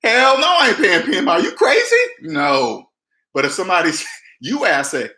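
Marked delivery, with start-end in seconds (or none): 0.0-2.9 loud, high incredulous scoff; brash mock outrage, pitch shooting up at the end; scoffing refusal. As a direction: laugh off the question like it's absurd. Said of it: "Now you ask a person like me".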